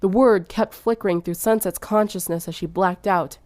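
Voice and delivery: usual speaking voice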